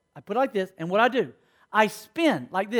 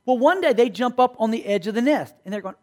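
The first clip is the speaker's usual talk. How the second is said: normal talking voice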